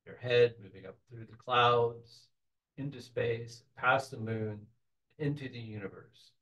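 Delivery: soft, unhurried meditation voice with long pauses; softly led visualization, phrase by phrase; guided meditation. Real, not acted. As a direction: gently guide the group into a relaxed trance.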